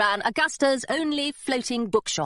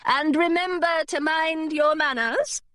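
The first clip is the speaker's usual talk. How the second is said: high, slow governess reminder; drawn-out, high-pitched fussy voice; former governess; 6 semitones higher than usual. Acted. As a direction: instruct a former charge primly. Former governess gives Hilary instructions.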